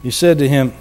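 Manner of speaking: normal talking voice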